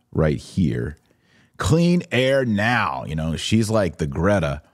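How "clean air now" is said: slow, drawn-out protest slogan, a touch higher; slogan read slow. Retold or imitated. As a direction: spell out the sign slowly and proudly. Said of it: "she has put down her sign".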